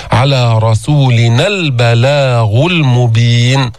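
slow, measured tajweed chanting; Quran recitation. Shown as a demonstration. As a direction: recite carefully as a model for the class. Recites verse.

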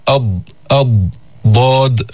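أض said with the tongue side pressed to the upper molars, emphatic ض; ḍād sound demo. Shown as a demonstration. Teacher showing the ض sound to students, emphatic. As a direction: isolate أض then ضاد, heavy and emphatic. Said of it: "هكذا".

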